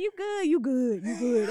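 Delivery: imitating the woman's deep voice at the concession stand. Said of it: "Why you get your voice deep?".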